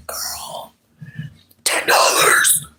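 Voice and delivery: whisper. Pretend. Whispered despair.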